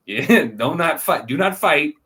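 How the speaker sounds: high, loud, drawn-out mock warning; laughing warning. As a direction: warn them off through a laugh. Joking warning to the heroes.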